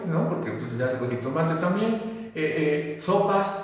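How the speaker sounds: ordinary delivery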